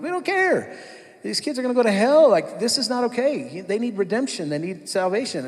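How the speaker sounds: urgent pleading in a staff meeting; impassioned plea. Retold or imitated. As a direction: beg them to see the stakes, voice climbing.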